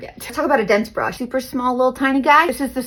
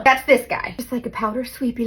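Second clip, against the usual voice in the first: normal talking voice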